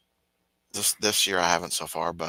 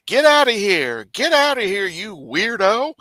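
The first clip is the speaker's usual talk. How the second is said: high, loud get-out, slow on weirdo; mock shooing; 11 semitones higher, 4 dB louder, slower than usual. [name] booting Harvey off the panel, high loud mock shout. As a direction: shoo him off the stage.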